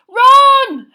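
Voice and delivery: loud desperate call of a friend's name; shout. A narrator's reading. Boy yelling after his dragged friend, full shout. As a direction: yell it after him as he vanishes. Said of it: "Harry shouted".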